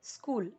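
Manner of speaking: स्कूल starting straight on the s; correct pronunciation. Shown as a demonstration. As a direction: say स्कूल as "skool". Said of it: "तो wrong हो जाएगा".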